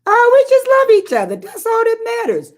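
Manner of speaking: high, fast mock voice of a naive bride; naive younger self. Telling how she once brushed off the advice, in a high breezy mock voice. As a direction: play your younger self, breezy and clueless. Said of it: "I was like".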